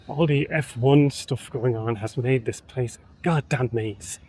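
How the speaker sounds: ordinary talk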